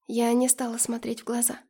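plain reading voice

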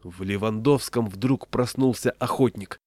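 usual speaking voice

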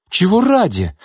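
furious retort, pitched high; angry exclamation. Acted. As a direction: snap back at him in anger. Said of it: "злобно воскликнула Лина".

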